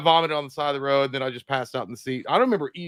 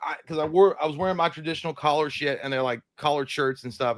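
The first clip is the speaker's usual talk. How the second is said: ordinary talk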